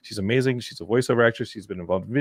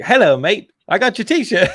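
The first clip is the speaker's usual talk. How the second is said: put-on Australian accent; 10 semitones higher, 6 dB louder than usual. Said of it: "try and say his accent on the show, and it's gonna be terrible".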